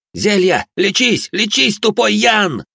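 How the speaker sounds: high furious yell; яростный крик. A narrator's reading. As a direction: yell it out with all the rage. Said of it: "заорал, уплёскивая из себя всю ярость". (яростный ор)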